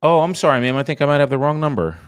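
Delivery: smooth over a wrong number politely; polite phone voice. Real, not acted.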